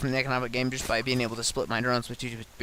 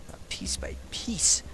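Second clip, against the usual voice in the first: whisper; 7 semitones higher, 5 dB quieter, slower than usual. Acted. Dramatic threat of being torn apart.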